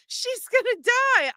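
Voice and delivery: high, horrified wail; panicked cry. Retold or imitated. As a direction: cry out in alarm as if watching her die. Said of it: "I was like".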